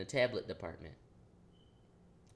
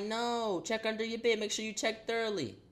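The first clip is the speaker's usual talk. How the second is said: lightly voiced mom, higher pitch; mom's voice, pitched up; 8 semitones higher than usual. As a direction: play mom telling him to check under the bed, pitched up. Voicing mom's reply.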